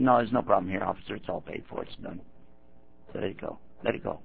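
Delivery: soft, fading almost to a whisper; quiet dismissal. Retold or imitated. Forgiving brother quietly telling the officer to let it go. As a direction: wave it away gently, dropping to nothing.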